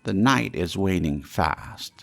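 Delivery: put-on loud, pressing old man; urgent Scrooge. Scrooge urges the phantom to lead on, loud and pressing. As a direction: push the ghost onward, time is short.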